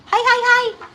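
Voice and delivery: high, squeaky hi hi hi; excited greeting. Real, not acted. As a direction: greet the viewer in a delighted squeal. Greeting a chat viewer.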